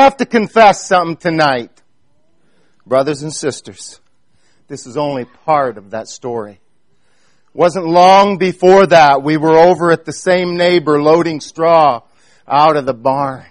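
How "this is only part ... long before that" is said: ordinary talk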